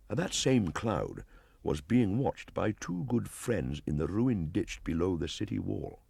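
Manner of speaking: ordinary delivery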